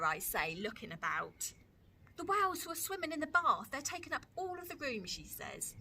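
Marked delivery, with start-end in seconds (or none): 2.2-5.0 high, cheeky little sister; a small girl insisting, higher; Lola's little-girl voice. Acted. Lola voiced by reader.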